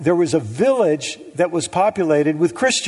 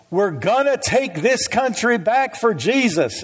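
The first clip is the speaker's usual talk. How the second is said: slow, higher Southern preacher twang; 5 semitones higher than usual. Retold or imitated. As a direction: imitate a radio preacher, stretched and twangy. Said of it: "she just got this twang".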